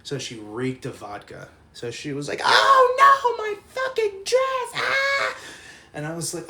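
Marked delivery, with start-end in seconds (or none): 2.4-5.4 very high wailing scream; mock shriek. Girl whose costume got soaked, hammed-up shriek. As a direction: imitate a distraught, screaming girl.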